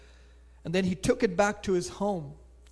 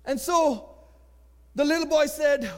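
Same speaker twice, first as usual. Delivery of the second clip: ordinary delivery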